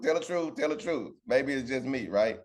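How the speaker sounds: ordinary talk, nothing marked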